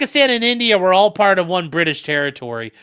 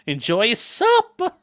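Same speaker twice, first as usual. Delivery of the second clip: high, slow "supper" in mock accent, final r dropped; hammed-up r-less accent on "supper"; 8 semitones higher, slower than usual. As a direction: draw out "supper" as "suppa" in a put-on accent. Same mock call, stretched.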